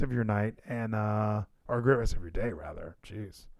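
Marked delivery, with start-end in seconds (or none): none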